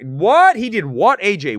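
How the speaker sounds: high, loud incredulous outburst; loud disbelief. Real, not acted. Host hearing about Soups' backlash, shocked disbelief. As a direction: blurt it out, stunned by the news.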